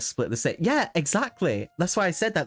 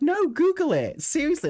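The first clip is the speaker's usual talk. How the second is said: exasperated high insistence; 14 semitones higher than usual. Retold or imitated. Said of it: "I'm like".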